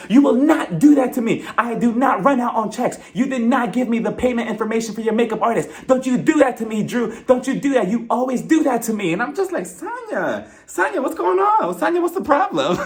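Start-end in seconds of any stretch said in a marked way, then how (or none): 0.1-9.1 angry yell. Retold or imitated. Sonya blows up at Drew. 9.7-12.8 bewildered plea. Retold or imitated. Speaker voices own bewildered reply.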